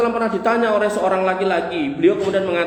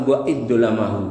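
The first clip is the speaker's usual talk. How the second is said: lower, settled answering voice; Prophet's calm answer; 7 semitones lower than usual. Retold or imitated. Prophet answers the wronged son, lower and calm. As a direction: answer it gently, with authority. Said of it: "Kata Nabi sallallahu alaihi wasallam".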